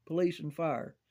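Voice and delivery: usual speaking voice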